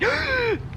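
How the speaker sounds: high startled gasp; sharp gasp. Real, not acted. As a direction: gasp in sudden alarm. Bug lands on friend.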